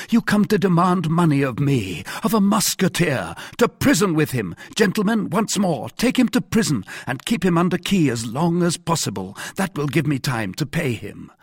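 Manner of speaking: loud, high-pitched mock outrage; indignant commands. A narrator's reading. D'Artagnan sends the mercer to prison, loudly indignant.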